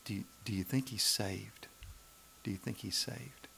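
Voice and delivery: hushed, confiding whisper; whispered question. Retold or imitated. Woman in the marketplace whispering about a man, hushed. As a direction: whisper it so he won't hear.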